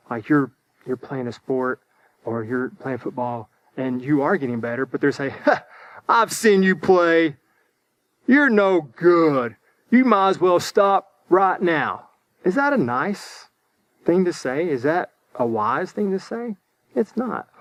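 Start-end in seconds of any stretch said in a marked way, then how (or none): 5.4-12.1 slow, louder acted scorn; mock put-down. Unwise person discouraging a football player, slow mock scorn. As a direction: play a discouraging critic, drawing out the insult. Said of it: "maybe an unwise person would hear that".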